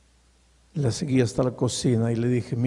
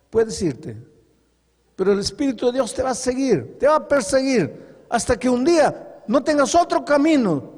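fiery preaching; 9 semitones higher than usual. Acted. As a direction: preach it at full force to the congregation. Preacher retells a testimony forcefully.

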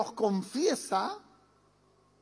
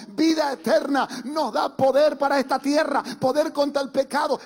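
rising to louder, almost shouting preacher's list; fervent preaching; 4 semitones higher, 4 dB louder than usual. Acted. Preacher to congregation listing God's mercies, building to fervent shouts. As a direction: build each line with growing fire until you near a shout.

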